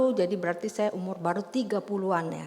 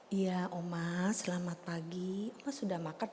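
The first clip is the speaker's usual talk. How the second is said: put-on elderly-care talk; 13 dB quieter than usual. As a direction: speak gently and unhurriedly to an old lady. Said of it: "Nanti kamu ngomongnya begini".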